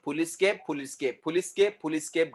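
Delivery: "फुल स्केप" said pu-lis-kep; mispronounced "फुल स्केप" in three syllables; wrong pronunciation. Shown as a demonstration. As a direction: repeat "फुल स्केप" with an extra i in the middle. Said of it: "गलत".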